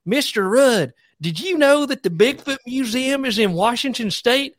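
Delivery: high, eager little schoolboy; Dalton, country kid. Retold or imitated. Second grader asking teacher for a field trip, high eager kid voice. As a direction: pipe up like a kid bursting with a Bigfoot fact. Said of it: "he would be like".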